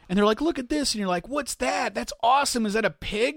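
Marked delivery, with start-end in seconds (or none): 0.4-0.9 put-on proud kid. Kid showing drawing. 1.3-3.4 fake enthusiasm. Parent faking enthusiasm.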